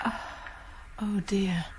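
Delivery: soft, voiceless dismay; dismayed whisper. Acted. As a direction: let it slip out under your breath. Reacting to murder by injection.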